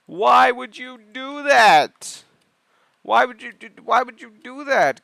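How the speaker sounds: loud, high-pitched, incredulous pleading; exasperated yelling. Pretend. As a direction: yell at them in comic disbelief. Exasperated at people nearing wild animals.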